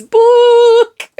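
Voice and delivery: high, loud imitated scream of "Book!"; mock scream. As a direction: shriek "Book!" like the movie witch. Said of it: "and she screams".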